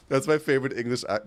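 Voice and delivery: ordinary talk, nothing marked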